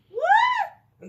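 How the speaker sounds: very high, loud startled what; shocked shriek. Real, not acted. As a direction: squeal it in disbelief. Sudden shocked reaction on camera.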